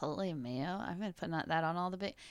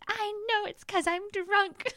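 high, slow, sing-song tipsy voice; drunk self-aware girl; 14 semitones higher, 12 dB louder, much slower than usual. Retold or imitated. Imitating her drunk self answering friends in the car. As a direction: play the tipsy brat who knows it.

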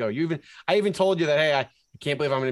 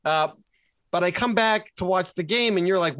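ordinary talk, nothing marked

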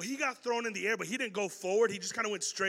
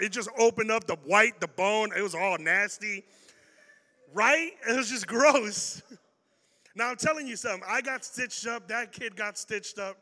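normal talking voice

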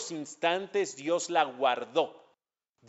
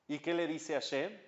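ordinary delivery

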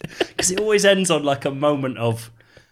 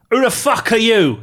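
high, loud salesman's outburst; brash challenge; 12 semitones higher, 8 dB louder than usual. A narrator's reading. Salesman replying to smiling Gav, brashly aggressive. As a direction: blast it at a stranger.

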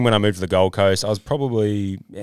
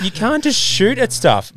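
exasperated; 13 semitones higher, 4 dB louder, slower than usual. Real, not acted. Exasperated about guns.

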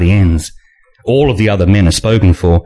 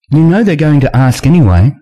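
Nina voice; 6 semitones higher than usual. A narrator's reading.

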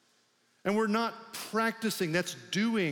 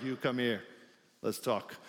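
put-on lightly done old Brooklyn man, lower and softer; a half-hearted Brooklyn old-timer beckoning a kid; Brooklyn accent; 6 semitones lower, 4 dB quieter than usual. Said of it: "he would have been from Brooklyn".

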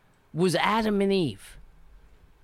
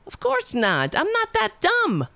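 indignant retort; 7 semitones higher than usual. Retold or imitated. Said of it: "I was like".

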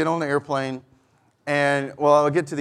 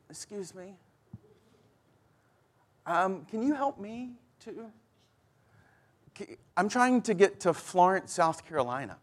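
put-on timid young lady; 5 semitones higher, 6 dB quieter than usual. Pastor voicing a stranded young woman asking for help, timid and soft. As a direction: ask shyly, almost apologizing.

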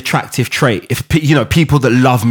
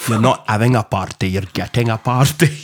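Kevin Bridges impression; 5 semitones lower, 4 dB quieter than usual. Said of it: "Kevin Bridges".

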